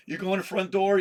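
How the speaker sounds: usual speaking voice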